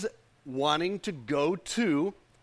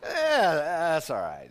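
dismissive; slower than usual. Acted. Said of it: "You can even say it like that".

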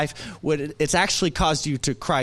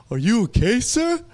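higher, lightly voiced concern; concerned old gentleman; 6 semitones higher, 4 dB louder than usual. Old man checking on a tearful stranger, concerned. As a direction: imitate a kind man's rising, worried question. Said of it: "he sees my eyes welled up".

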